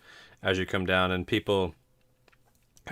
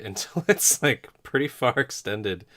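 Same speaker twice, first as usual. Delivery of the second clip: normal talking voice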